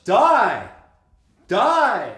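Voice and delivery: very loud, high yells, falling at the end; hammed-up repeated death command; mock villain shout. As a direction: belt each one out like a melodramatic witch. Acting out the witch's line.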